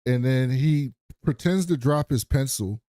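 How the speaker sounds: ordinary delivery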